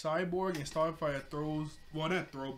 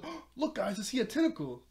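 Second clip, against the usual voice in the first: distracting Beast Boy; 8 semitones higher, 4 dB louder than usual.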